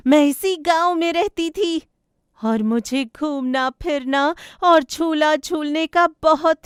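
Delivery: put-on high, loud ghost girl recounting her past; ghost bird Lado.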